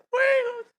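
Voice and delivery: put-on boy yelling fire in a smoke-filled house; panicked shout. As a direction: scream it in a blind panic. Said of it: "como pollo sin cabeza".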